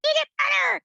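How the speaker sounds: put-on child's squeaky dragon roar. Shown as a demonstration. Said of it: "your dragon voice".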